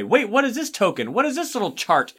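high-pitched, mock-baffled questions; puzzled player. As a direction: act out finding one mystery piece after another. Acts out player finding odd components.

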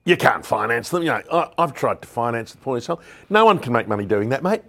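a loud mock rant, rising to a yell at the end; gruff Jerry. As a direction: bark it at them like blunt Jerry, mildly hammed. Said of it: "That's how Jerry speaks".

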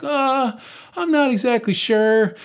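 nervous uncertainty. Retold or imitated. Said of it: "And I'm like".

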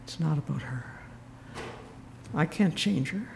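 quiet. Shown as a demonstration. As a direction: let the lesson sink in quietly. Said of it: "And it was a lesson".

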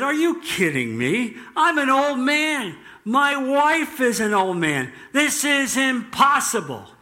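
high-pitched, incredulous protest; mocking disbelief. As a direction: scoff in hammed-up disbelief. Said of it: "mocked the angel and said".

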